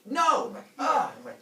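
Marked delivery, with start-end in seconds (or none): 0.0-0.5 high, loud refusal; hammed-up frantic objection; exasperated shout. Prometheus stops the humans. 0.8-1.1 high, loud mock scream; comic cry of alarm; startled yelp. Humans startled by the shout.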